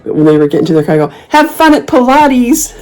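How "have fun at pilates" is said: high, slow, drawn-out call; obnoxious taunt. Retold or imitated. Calling to the rude couple at their car, obnoxiously. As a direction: holler a mock send-off across the lot. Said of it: "I was so obnoxious".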